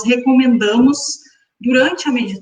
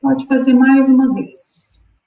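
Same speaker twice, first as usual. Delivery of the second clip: guided meditation. Acted. Guides breathing exercise.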